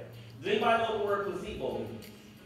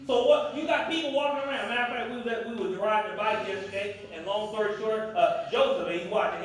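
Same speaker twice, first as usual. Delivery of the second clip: ordinary talk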